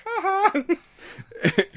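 very high mock "ha ha"; hammed-up high cackle of surprise; imitated triumphant laugh. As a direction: pop up with a gotcha laugh. Imitates pilot popping back up alive.

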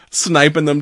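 ordinary talk, nothing marked

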